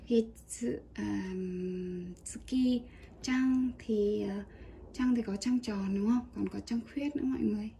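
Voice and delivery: ordinary talk, nothing marked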